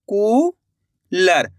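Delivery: "Cooler" split slowly, long rising कू, pause, falling लर; drawn-out syllables. Shown as a demonstration. Teacher completing the word for learners, "cooler" sounded out slowly in two parts.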